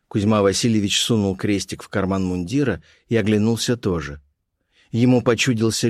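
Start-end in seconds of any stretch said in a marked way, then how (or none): none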